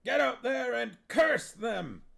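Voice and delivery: high, loud impatient yell. Acted. Huel yelling at the witches, loud high. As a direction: shoo them out with a yell.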